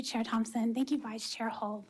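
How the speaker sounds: normal talking voice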